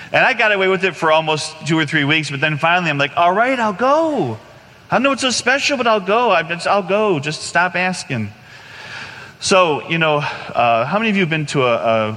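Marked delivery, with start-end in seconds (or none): 3.2-8.3 exasperated giving in. Retold or imitated. Man worn down by friend's invitations, exasperated surrender. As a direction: give in under protest, pitch sinking as you go.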